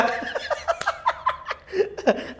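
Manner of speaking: high-pitched breathless squeal of laughter; cracking up at the punchline. Real, not acted. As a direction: shriek with laughter.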